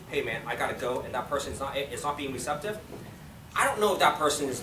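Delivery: ordinary delivery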